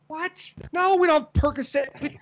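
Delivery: high-pitched, incredulous retort. Retold or imitated. Frustrated patient's companion answering clinic staff, incredulous. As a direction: act out disbelief, pitched well up.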